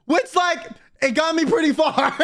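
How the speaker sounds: high-pitched, through real laughter; laughing. Real, not acted. Storyteller amused by his lack of talent, laughing. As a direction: squeeze the words out while cracking up. Laughing at own personality-hire joke.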